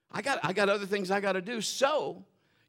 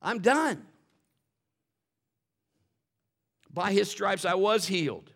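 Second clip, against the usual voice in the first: higher and louder, a mother who has had enough; fed-up mom; 4 semitones higher, 6 dB louder than usual. Retold or imitated. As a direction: put your foot down like an angry mom. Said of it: "Be like my mom".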